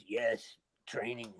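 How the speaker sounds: imitating dad's low, froggy voice. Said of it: "like, a froggy voice".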